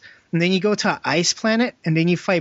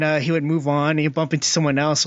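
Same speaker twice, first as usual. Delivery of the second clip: ordinary talk, nothing marked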